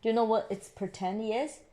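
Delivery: ordinary delivery, nothing marked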